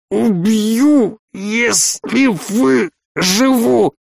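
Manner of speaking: high, choked voice, broken by pauses; strangled threat. A narrator's reading. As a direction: squeeze out a threat while being throttled. Major being strangled, threatens.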